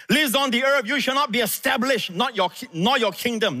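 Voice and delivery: high-pitched fury at a son; Saul's rage. A narrator's reading. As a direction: scold your son like an enraged king. Saul raging at Jonathan.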